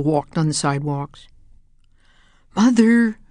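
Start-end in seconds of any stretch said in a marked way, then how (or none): 2.6-3.2 raised, high call. A narrator's reading. James calls for his mother, raised and high. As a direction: call out searching. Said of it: "James called".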